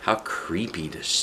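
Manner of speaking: plain reading voice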